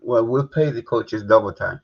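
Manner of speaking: usual speaking voice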